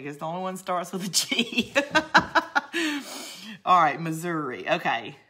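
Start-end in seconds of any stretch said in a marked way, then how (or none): 1.8-3.6 high-pitched burst of laughter; amused laugh. Real, not acted. Crafter joking that Georgia is the easiest one, amused laugh. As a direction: laugh freely at your own little joke. Laughs at her own joke.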